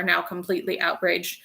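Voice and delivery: usual speaking voice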